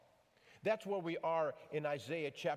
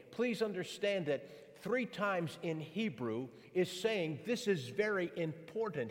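usual speaking voice

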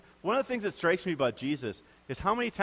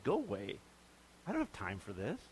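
dismissive brush-off; 7 semitones lower, 8 dB quieter than usual. Pretend. Imagined dismissive Jesus.

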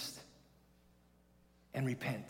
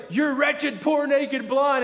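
loud, higher rebuke; stern accusing God; 11 semitones higher, 9 dB louder, slower than usual. Retold or imitated. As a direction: call out every word as a charge. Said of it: "he's like".

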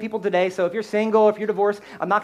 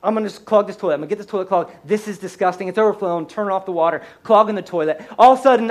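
usual speaking voice

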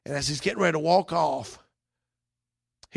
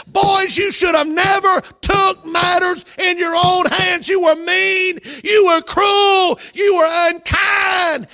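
much higher and louder, slow, hammering each "You"; a father scolding his sons, shouting each line out; father's angry rebuke; 12 semitones higher, 8 dB louder, much slower than usual. Acted. Jacob scolding his sons for the slaughter, loud and slow.

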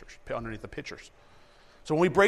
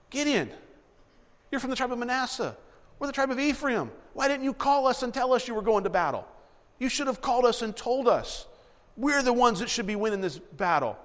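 higher, sharp indignant complaint; indignant reprimand; 8 semitones higher than usual. Retold or imitated. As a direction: scold him like a slighted tribe. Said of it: "do you catch the tone".

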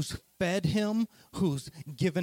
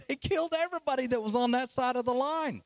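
fast and high with a laugh; laugh, then rush it out high; high, amused, hurried telling; 7 semitones higher, faster than usual. Real, not acted. Laughs while retelling the slaughter.

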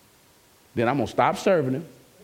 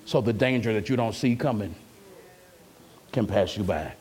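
Jesus as the door; 7 semitones lower, 3 dB quieter than usual. Retold or imitated. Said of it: "he can say".

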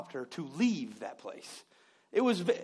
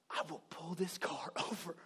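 threatening whisper; 3 semitones lower, 11 dB quieter than usual. Retold or imitated. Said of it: "But you, you".

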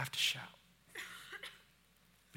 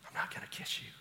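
much softer, lower private words; quiet personal aside; 4 semitones lower, 8 dB quieter, faster than usual. Real, not acted. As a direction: speak gently to one person up front.

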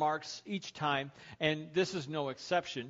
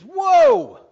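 very high, loud drawn-out cry; panicked yell; 21 dB louder than usual. Acted. Man headed over a cliff, panicked yell. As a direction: scream at the horse to halt. Horse racing toward a cliff.

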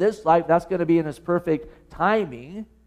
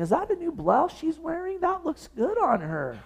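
a lady in the pew admiring a blouse, higher and softer; imagined gossip; 10 semitones higher, 6 dB quieter than usual. Pretend. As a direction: churchgoer whispering about a new blouse, high and soft.

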